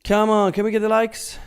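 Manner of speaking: loud, high-pitched plea to viewers; calling out. Real, not acted. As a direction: call out high and loud to the chat. Streamer calls out for likes.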